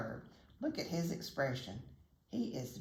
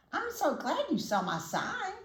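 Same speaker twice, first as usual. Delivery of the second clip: high, bright chirping bird voice; chirpy bird; 10 semitones higher, 3 dB louder than usual. A narrator's reading. Little bird welcoming the dog, chirping high. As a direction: chirp it like a tiny eager bird. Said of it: "the bird chirped".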